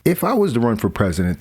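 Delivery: usual speaking voice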